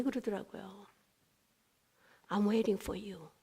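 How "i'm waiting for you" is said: slow, soft reply. Retold or imitated. God's reply quoted.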